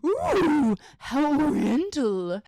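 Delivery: drawn-out, slow, fawning Ooh, how oriental; mocking theory nerds. Host mocking theory nerds, slow fawning coo. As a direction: sneer at the exoticizing fans. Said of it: "theory nerds ... went".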